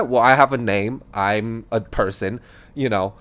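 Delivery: ordinary delivery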